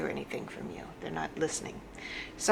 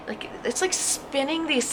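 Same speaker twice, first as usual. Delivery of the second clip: normal talking voice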